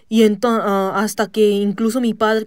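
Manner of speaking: ordinary delivery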